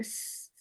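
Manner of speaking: a sustained whistling sss with no vowel; whistling hiss. Shown as a demonstration. Teacher demonstrating the whistle of the letter sin, sustained hiss. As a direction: hold a thin hissing s like a whistle. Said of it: "سین کی آواز سیٹی کی آواز ہے".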